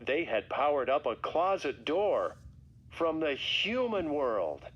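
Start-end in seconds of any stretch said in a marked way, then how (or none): none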